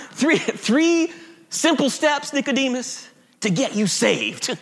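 mock Southern evangelical, near laughing; southern accent. Evangelical Jesus pitching salvation to Nicodemus, mock southern accent. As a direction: sell it in a put-on Southern accent. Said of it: "Jesus has a southern accent".

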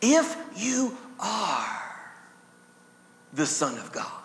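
slow, soft insinuating tempter; slithering tempter. Acted. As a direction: draw it out slowly like a slithering tempter. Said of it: "with his slithering question".

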